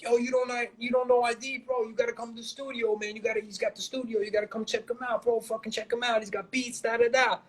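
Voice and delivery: high-pitched, hurried mock sales pitch; animated imitation. Imitating his friend inviting rappers to the studio, animated. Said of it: "he was real animated".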